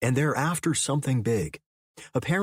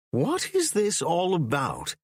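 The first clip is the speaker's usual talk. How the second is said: slow, higher, displeased English professor; scowling; 7 semitones higher, slower than usual. A narrator's reading. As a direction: demand answers from unwelcome guests. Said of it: "Firth asked with a scowl".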